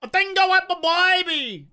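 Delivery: loud, high, exaggerated Australian twang; big Aussie twang. Shown as a demonstration. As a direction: ham up the Aussie accent with big inflection. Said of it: "the real big Aussie twang on it".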